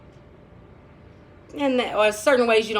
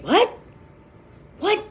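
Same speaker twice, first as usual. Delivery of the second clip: high, louder retold "what?"; startled reaction; 11 semitones higher, 4 dB louder than usual. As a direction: act out being taken aback. Retold startled reaction to his remark.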